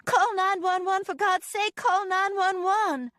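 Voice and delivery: high, urgent shouting, slowing at the end; frantic call for help. A narrator's reading. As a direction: beg them to call, panicked. Sister shot, calling for help.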